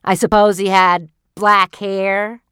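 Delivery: husband mocking his wife's dream; sarcastic husband. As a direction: needle her with a sneer.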